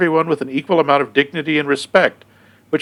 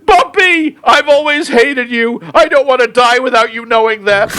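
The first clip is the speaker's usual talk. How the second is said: high shrieking mock panic; panicked screaming; 10 semitones higher, 4 dB louder than usual. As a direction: wail like the world is ending. Mock end-of-world panic.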